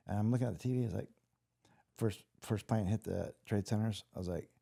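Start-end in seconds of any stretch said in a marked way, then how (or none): none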